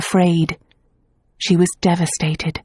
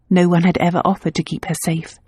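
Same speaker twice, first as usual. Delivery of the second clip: plain reading voice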